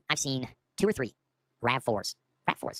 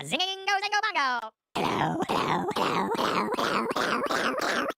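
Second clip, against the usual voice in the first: goofy nonsense chant climbing higher on each hello; silly sing-song; much slower than usual. Pretend. Shaking off an adrenaline scare, goofy sing-song chant. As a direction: shake off the scare with a playful rising hello.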